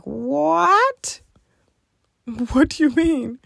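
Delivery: whispered gasp rising high, then a stunned question; can't believe what the doctor just said; breathless, high-pitched what; shocked disbelief. Retold or imitated.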